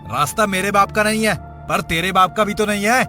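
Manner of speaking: quick, irritated comeback; rude retort. Acted. Rude man answers the scolding boy, irritated. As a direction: fire back quickly and insolently.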